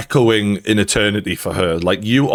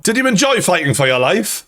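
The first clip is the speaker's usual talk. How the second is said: sarcastic mock question; 8 semitones higher than usual. Mocking the dog owner.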